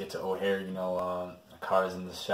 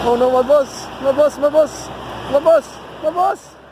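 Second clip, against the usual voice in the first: panicked shouting; 10 dB louder than usual. Acted. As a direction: scream it in a panic. Panicked shouting about the boss.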